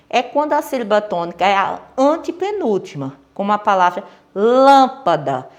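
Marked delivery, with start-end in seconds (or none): 4.3-5.5 lâmpada with a long, loud first syllable; stress on LÂM. Shown as a demonstration. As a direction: stretch and push the LÂM in lâmpada. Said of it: "A sílaba tônica da palavra lâmpada é lã".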